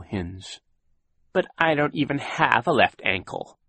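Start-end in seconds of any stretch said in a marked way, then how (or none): 1.3-3.6 whiny, self-pitying complaint. A narrator's reading. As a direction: whine it out for sympathy. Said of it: "he said in a whiny voice".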